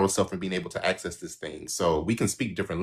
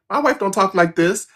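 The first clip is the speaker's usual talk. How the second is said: indignant husband; 11 semitones higher, 6 dB louder than usual. Retold or imitated. Husband voiced in retold story.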